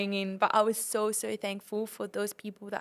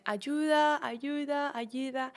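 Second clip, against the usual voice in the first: high sing-out cry, fading on each repeat; retold call for help; 4 semitones higher, slower than usual. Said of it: "we started asking for help".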